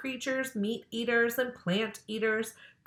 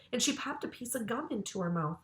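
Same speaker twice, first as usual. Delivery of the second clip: usual speaking voice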